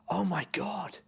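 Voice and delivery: imitating an excited fan spotting a celebrity. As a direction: gush like a star-struck fan. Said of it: "so excited".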